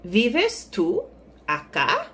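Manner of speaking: slow, word-by-word question, "acá" rising; slow teacher's demo, "acá" lifted at the end; question example. Shown as a demonstration. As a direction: space out "Vives", "tú", "acá" with a questioning lift. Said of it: "An example of how we have".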